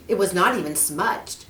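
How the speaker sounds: ordinary delivery, nothing marked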